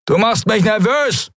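high, wired, aggressive thug voice; high, jittery bullying pirate; drugged-up pirate. A narrator's reading. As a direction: snap at her like a pirate high on drugs. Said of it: "von Silberfeuer aufgeputscht".

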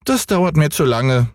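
hohe Kinderstimme, ungeduldig; kleiner Häwelmann. A narrator's reading. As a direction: ein quengelnder kleiner Junge, deutlich höher. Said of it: "sagte Häwelmann". (hohe, ungeduldige Kinderstimme)

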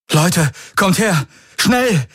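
high, alarmed cry to the others; startled call. A narrator's reading. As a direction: call them over in sudden alarm. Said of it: "rief er erschrocken". (erschrocken herbeirufen)

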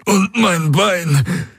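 high, slow strained voice of an injured man; wounded soldier. Acted. Wounded Hawks telling Kirai his leg is broken, strained high voice. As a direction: push the words out through pain.

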